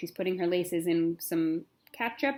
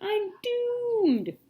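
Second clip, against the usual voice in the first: mock despair; 13 semitones higher than usual. Girl despairs before school.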